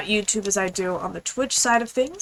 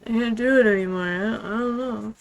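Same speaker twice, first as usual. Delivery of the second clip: mock exhausted whine; 3 dB quieter than usual. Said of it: "someone would be like".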